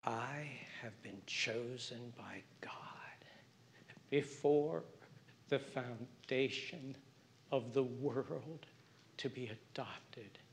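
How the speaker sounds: slow, hushed whisper; intimate whisper. Real, not acted. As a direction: breathe it out like a secret blessing. Preacher whispers assurance to congregation.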